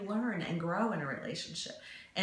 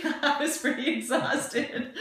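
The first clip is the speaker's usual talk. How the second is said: laughing through the words; laughing about the long presentation; 8 semitones higher, 4 dB louder than usual. Real, not acted. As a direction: giggle it out, amused at herself.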